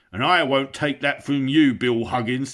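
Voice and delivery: loud insulting troll voice; angry troll. Acted. Bert insults William in a quarrel, loud. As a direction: spit out the insults.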